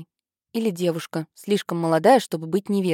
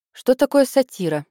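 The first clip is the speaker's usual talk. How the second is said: put-on higher-pitched questioning child; curious little sister; 7 semitones higher, 4 dB louder than usual. A narrator's reading. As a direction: pipe up with an innocent question. Younger sister cuts in curiously.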